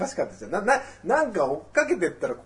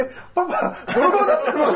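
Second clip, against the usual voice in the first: put-on much higher, laughing child asking papa; giggling child, louder at the end; mock child's voice; 12 semitones higher, 7 dB louder than usual. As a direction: squeak it out like a shocked little kid. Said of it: "みたいなさ".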